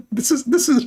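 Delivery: laughing high through the words. Real, not acted. Mocking the hadith he just quoted, laughing. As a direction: breaks into a high laugh at his own joke.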